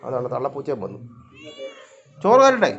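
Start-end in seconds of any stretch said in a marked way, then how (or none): 2.2-2.7 loud, very high pet-talk voice. Real, not acted. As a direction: call out high to hungry cats. Offering food to her cats. (പൂച്ചയോട് ഉറക്കെ, ഉയർന്ന ശബ്ദത്തിൽ)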